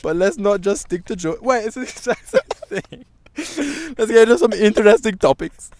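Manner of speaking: bad Caribbean accent, slipping. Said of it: "in a Caribbean accent".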